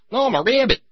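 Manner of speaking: high, innocent cartoon rabbit; cartoon rabbit reply. Bugs Bunny answering the angry cop, high and innocent.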